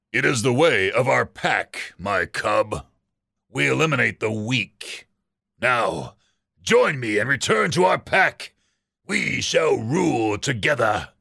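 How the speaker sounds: lightly voiced cruel alpha; a hint of a menacing father ruling his cub; cold pack leader. Villain father addresses his stepson.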